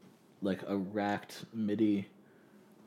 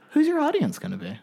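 high mock mom question; 5 dB louder than usual. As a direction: ask like a curious mother. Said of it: "She's like".

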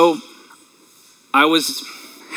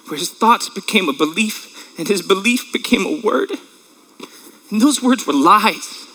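choked up; 3 semitones higher than usual. Real, not acted. Father telling the church about his son, choked with tears. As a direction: voice breaking, holding back tears.

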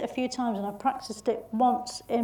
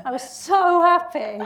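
laughing with joy; 7 semitones higher, 6 dB louder than usual. Real, not acted. Recalling getting the part.

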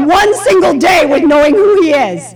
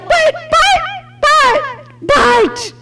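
much higher stammering buts; flailing Moses; 12 semitones higher, much slower than usual. Retold or imitated. Moses flailing before God, high stammering objections. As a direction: sputter helplessly like cornered Moses. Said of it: "the rest of that chapter is him like".

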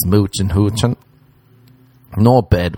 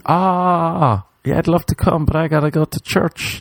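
high put-on girl voice; 8 semitones higher than usual. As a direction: lightly voice a pious girl. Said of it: "she's like".

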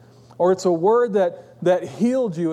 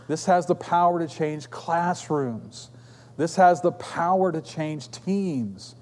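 slow, softer, measured repetition; preaching refrain; 2 semitones lower, 4 dB quieter than usual. Acted. Preacher stressing encouragement's power to congregation, slow and soft. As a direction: let each line land, slowly.

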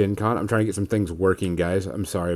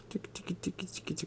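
whispered tick sounds; 7 semitones higher, 22 dB quieter than usual. Acted. Tickling guest's hair playfully.

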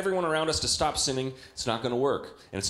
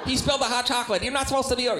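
high, louder whiny tattle through laughs; annoying kid voice; 10 semitones higher, 5 dB louder, much faster than usual. Retold or imitated. Imitating the Polar Express kid, high laughing whine. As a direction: squeal it like a smug tattling kid. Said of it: "the voice was like".

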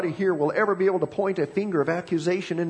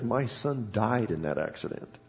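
low, softer, mournful, no real sobs; a hint of tears in a father's voice; understated weeping doctor; tearful doctor; 5 semitones lower, 4 dB quieter than usual. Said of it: "And with tears".

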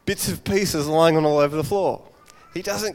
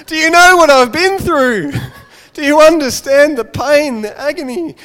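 high, much louder mock-despairing cry; hammed-up anguished wail; 11 semitones higher, 12 dB louder, slower than usual. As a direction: wail at mum as if the world ended. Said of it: "the son's kind of like".

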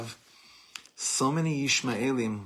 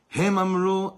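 rising sermon fervor; 9 semitones higher, 8 dB louder, slower than usual. Acted. Fiery sermon refrain.

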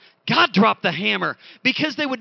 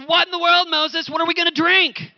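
much higher, louder whining; whiny complaint; 9 semitones higher, 4 dB louder than usual. Acted. Preacher mimics thirsty Israelites whining at Moses, high and shrill. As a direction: whine at Moses like a spoiled crowd.

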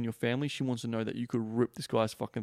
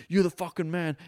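mock bro hype; mocking alpha bro; 6 semitones higher than usual. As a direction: pump him up like a meathead bro. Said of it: "It's like".

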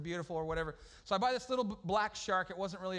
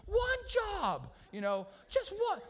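high yelling at a buddy; high-pitched frustrated yell; mock yell; 11 semitones higher, much slower than usual. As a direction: shout it up high, exasperated. Yelling at friend over lost fish.

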